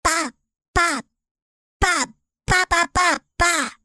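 put-on very high, excited baby babble. A narrator's reading. Baby king babbling at the visitors, high and gleeful. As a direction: squeal it like a happy baby waving his arms. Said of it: "cried the king, waving his arms".

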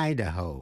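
"Idaho" stressed on opening I; first-syllable stress. Shown as a demonstration. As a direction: say EYE-da-ho for "Idaho". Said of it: "Nhấn mạnh vào vần đầu". ("Idaho" nhấn vào vần đầu I)

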